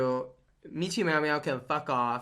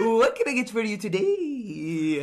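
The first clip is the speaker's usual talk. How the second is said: eunuch voice; 5 semitones higher, 4 dB louder than usual. Retold or imitated. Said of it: "He's like".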